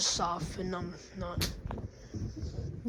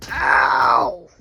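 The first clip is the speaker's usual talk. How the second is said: pained shout; loud yell of pain; 4 semitones lower, 12 dB louder than usual. Acted. Toy shouts in pain after the fan hits. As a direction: cry out as you're struck.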